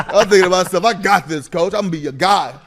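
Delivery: keen newcomer vowing loyalty in his head; eager inner voice. Retold or imitated. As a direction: play the eager rookie promising the coach. Eager inner monologue to coach.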